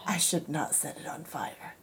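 hushed retold whisper to herself; student quietly talking herself out of a fire, whispered. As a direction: breathe the warning under your breath. Retold self-warning, whispered.

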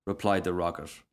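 ordinary delivery, nothing marked

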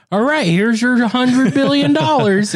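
high, slow, grand payout voice; mock big shot. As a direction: hand over the billions grandly. Mocking rich investors handing money.

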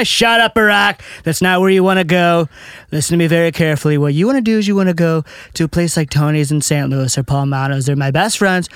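bragging presidential impression in run-on phrases; Trump. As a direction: cut in and boast like a brash politician. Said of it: "what would Trump and Obama say".

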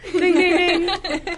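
high loud laughing ding ding; game show ding. Pretend. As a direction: ring it out like a quiz host. Game show correct answer.

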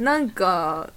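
normal talking voice

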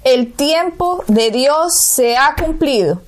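ordinary talk, nothing marked

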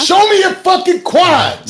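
loud, rowdy high yell; shouting. Real, not acted. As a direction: yell it out hyped. Hyped crowd demand, shouting.

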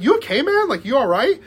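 high-pitched, questioning mock concern; imitated concerned check. Tripping friend checking on the soaked one, high concerned questioning.

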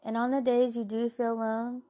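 ordinary talk

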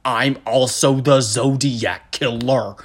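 slow, halting dopey impersonation; slow, heavy imagined politician voice; Kevin from The Office. Acted. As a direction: plod through it word by word. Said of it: "you just sound like Kevin from The Office".

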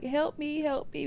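high, pleading cry for help; retold screaming. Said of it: "So I'm screaming".

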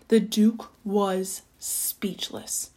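slow, hushed storytelling; soft, drawn-out amazement; stunned narration. A narrator's reading. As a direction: leave space between words, softly. Narrator marks the Duke's stunned silence.

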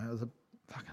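ordinary talk

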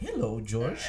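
lightly voiced creepy clown; a hint of a lurking clown; lightly voiced clown calling a child; Pennywise voice. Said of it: "sale la voz de Pennywise".

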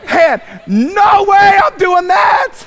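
put-on a kid refusing to be a missionary, shouting and laughing; laughing shout of refusal. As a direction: yell it from the front row, laughing. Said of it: "I'm on the front row going".

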